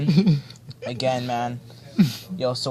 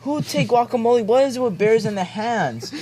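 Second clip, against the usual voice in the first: incredulous; 8 semitones higher than usual. Real, not acted. Shocked at the picture.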